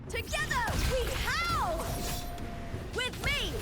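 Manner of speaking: high, ringing call to allies; rallying war cry. Acted. Fighter calls allies to howl together, high rallying shout. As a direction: call the pack to fight beside you.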